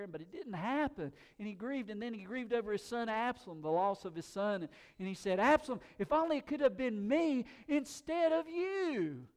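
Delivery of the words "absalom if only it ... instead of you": loud, high cry of a grieving father; anguished grief. Acted. David mourning his dead son Absalom, anguished cry. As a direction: wail it out in anguish. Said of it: "he felt deep grief".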